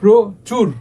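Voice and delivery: প্রচুর halted after "pro", then a strong "chur"; pressure in word. Shown as a demonstration. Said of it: "প্রচুরে একটু চাপ পড়ছে".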